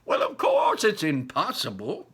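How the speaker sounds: put-on high, lively old man voice; a grandpa bursting with wonder; old grandfather. Grandpa Joe dazzling Charlie with Wonka's inventions, high and lively.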